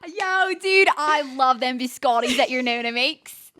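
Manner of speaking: loud, excited American bro act; playful mock enthusiasm; hammed-up dude. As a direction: gush loudly like an overkeen dude.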